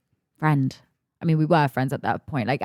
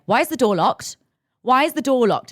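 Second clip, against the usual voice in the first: raised, higher imitation of an angry mother; demanding mock yell; 6 semitones higher, 4 dB louder than usual. Mother at the locked bathroom door, mock demanding yell.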